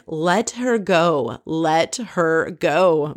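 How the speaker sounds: very slow, word by word; mild annoyance. Retold or imitated. Retelling Max telling Kelly to drop it, slow and mildly annoyed. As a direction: stretch each word in weary exasperation. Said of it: "Max is annoyed".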